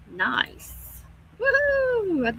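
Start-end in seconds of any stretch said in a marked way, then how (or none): 1.4-2.4 long, high celebratory woo; happy whoop. Real, not acted. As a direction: whoop with delight, gliding up high. Cheers at happy emojis in chat.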